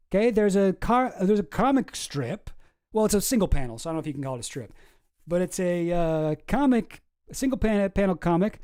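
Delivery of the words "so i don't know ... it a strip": normal talking voice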